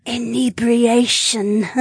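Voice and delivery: giddy tipsy lizard mage; tipsy woman voice. Acted. Drunk Naomi voiced.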